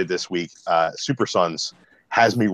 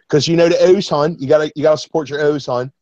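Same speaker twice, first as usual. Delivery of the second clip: Baltimore accent, higher and louder, stretching the O's; 7 semitones higher, 4 dB louder than usual. Shown as a demonstration. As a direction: cheer on the O's like a Baltimore local. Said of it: "some of my O's".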